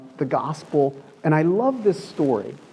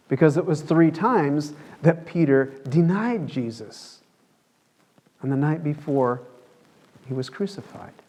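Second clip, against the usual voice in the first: ordinary talk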